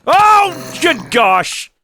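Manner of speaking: high, loud dismayed shout; shocked shout. Real, not acted. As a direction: yell in shock. Dying, loses gear.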